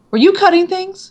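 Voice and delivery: high, suspicious question; mock-accusing brother. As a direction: grill her. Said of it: "he goes".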